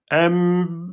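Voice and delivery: "M" hummed with the nose pinched so the sound stops; M nez bouché; nose-pinched M cut off. Shown as a demonstration. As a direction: pinch the nose and try to hum "M". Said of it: "ferme le nez".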